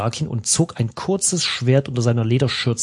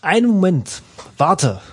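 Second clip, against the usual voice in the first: higher, louder call to wait; 6 semitones higher, 3 dB louder than usual. A narrator's reading. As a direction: shout after him to stop. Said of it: "rief der Schmied".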